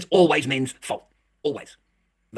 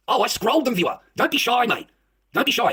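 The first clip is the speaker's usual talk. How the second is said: defiant exclamation; 8 semitones higher, 4 dB louder, slower than usual. Real, not acted. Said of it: "rants excitedly to viewers".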